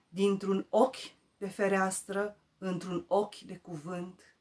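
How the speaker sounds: recitare de poezie. Acted. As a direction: declaim the verse, strong openings settling at line ends. Recites her poem. (poezie declamată, rostită apăsat)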